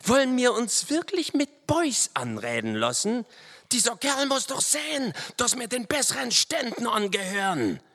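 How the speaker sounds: high-pitched, affronted grumbler; indignant Hobble Frank. A narrator's reading. Frank complains about being addressed as boys, indignant. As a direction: put on a high, offended character voice. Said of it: "brummte Hobble Frank".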